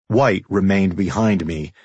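plain reading voice

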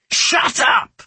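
high, forceful hissed outburst; angry hiss. A narrator's reading. Savar silencing her before onlookers, a fierce hiss. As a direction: hiss it harder, almost a shout. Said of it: "Savar hissed".

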